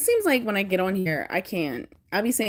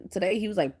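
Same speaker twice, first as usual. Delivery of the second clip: usual speaking voice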